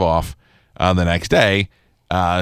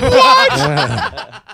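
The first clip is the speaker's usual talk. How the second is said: mock indignant; 4 dB louder than usual. Real, not acted. Mock-indignant reply to laughter.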